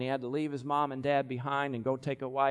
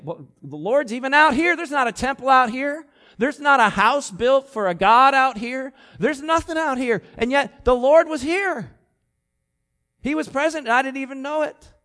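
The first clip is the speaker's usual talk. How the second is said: much higher and louder, astonished; amazed Jacob; 10 semitones higher, 9 dB louder than usual. Retold or imitated. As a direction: Jacob stunned that God is out here. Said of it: "And he's saying".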